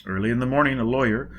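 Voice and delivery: usual speaking voice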